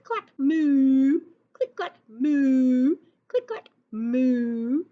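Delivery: loud drawn-out cow moos; mooing cows. Acted. Teacher voicing the typing cows with loud mooing.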